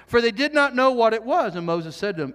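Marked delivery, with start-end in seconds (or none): none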